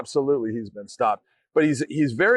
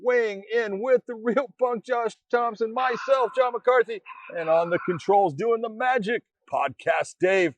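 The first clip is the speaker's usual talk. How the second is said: show intro; 7 semitones higher than usual. Acted. Host opens the show.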